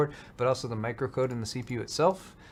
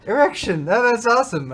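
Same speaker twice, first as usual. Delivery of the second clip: delighted; 8 semitones higher, 8 dB louder than usual. Real, not acted. Getting the pun, delighted.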